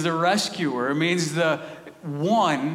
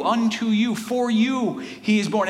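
usual speaking voice

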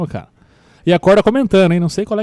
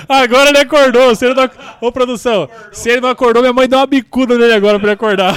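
laughing; 7 semitones higher, 5 dB louder than usual. Real, not acted. Laughing teasing about sleepy dad.

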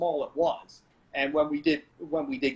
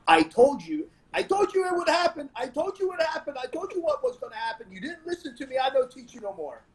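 put-on much higher, projected told-you-so scolding with a Portuguese accent; Eduardo scolding; 9 semitones higher than usual. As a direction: scold the student who would not listen. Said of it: "the way his voice projected".

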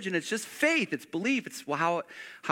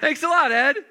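higher, laughing sarcasm; sarcastic thanks; 7 semitones higher, 5 dB louder than usual. Real, not acted. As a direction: thank him while laughing it off.

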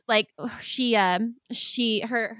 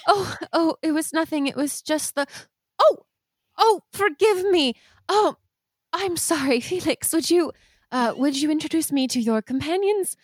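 higher, flustered, a little tearful; flustered mother; 6 semitones higher than usual. Acted. Mother composing herself after crying, to her son's friends. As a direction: gather yourself and apologize. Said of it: "she's dabbing her eyes".

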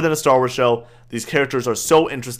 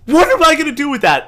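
incredulous; 13 semitones higher, 5 dB louder than usual. Retold or imitated. Retelling the episode, voicing disbelief.

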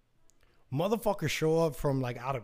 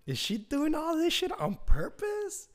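high, slow suspicious wondering; a drawn-out squeaky suspicion; 13 semitones higher, slower than usual. Retold or imitated. Wondering about the neighbor, high slow suspicious voice.

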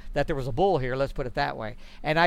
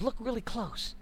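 softer, hushed hint; 5 semitones higher, 9 dB quieter than usual. Retold or imitated. Partner urging the hunter to look close, hushed. Partner quietly points out the dead bull.

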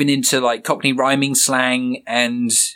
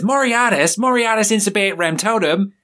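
much higher, put-on cartoon voice rattling off Latin; Rigby speaking Latin; 8 semitones higher than usual. Host voicing Rigby's Latin reply, much higher cartoon voice. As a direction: squeak it out like a cartoon raccoon. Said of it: "Rigby turns around and says".

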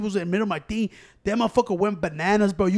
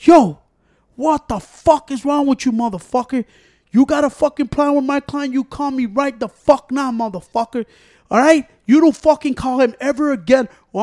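loud, high furious rant; angry cursing; 6 semitones higher, 7 dB louder than usual. Retold or imitated. Imitating the man who cursed him on the phone, loud angry rant. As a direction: bark it out like an enraged man.

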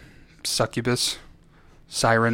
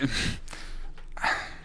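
breathy laugh; 10 dB quieter than usual. Real, not acted. Laughing at his own poodle impression.

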